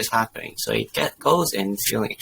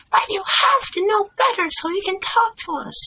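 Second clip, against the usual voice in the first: high, loud insistence; entitled mother; 5 dB louder than usual. A narrator's reading. Mother insists waiter improve his English, high and demanding.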